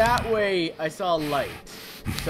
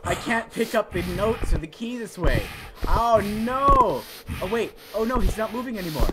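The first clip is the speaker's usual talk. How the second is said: usual speaking voice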